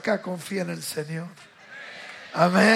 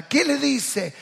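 ordinary talk

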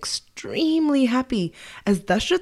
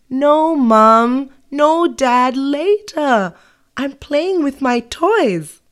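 put-on higher, louder kid whining no; whiny child; 6 semitones higher, 5 dB louder, slower than usual. Child whines to mum and dad about cleaning, higher and louder. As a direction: whine like a kid dodging chores.

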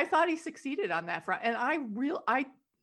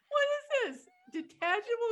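high, laughing incredulous question; giggling mock disbelief; amused mockery; 11 semitones higher than usual. Real, not acted. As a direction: scoff at it while laughing. Mocking the silly power.

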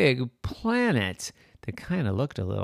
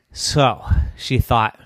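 usual speaking voice

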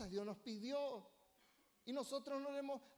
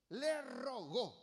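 slow and pleading, le rogó lingered on; le rogó said slowly like a tender favor; tender plea; 3 dB louder, much slower than usual. Shown as a demonstration. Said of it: "pidiendo con ternura".

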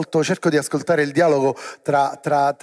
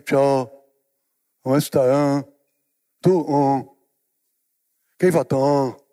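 mock dull greeting, a bit lower; lazy mumbled greeting; 3 semitones lower, slower than usual. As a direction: imitate a bored, sluggish hello. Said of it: "Sembriamo delle mucche". (saluto svogliato e biascicato, imitato per scherzo)

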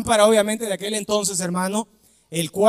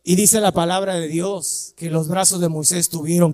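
ordinary delivery

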